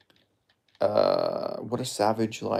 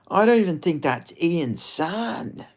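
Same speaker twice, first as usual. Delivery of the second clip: higher, slow, altered catchphrase voice; 6 semitones higher, slower than usual. Shown as a demonstration. Streamer repeats his favourite line in a slow, higher Jack Della Maddalena voice. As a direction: draw it out slow and high like the fighter. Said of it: "all I like to try and say in a J D M voice".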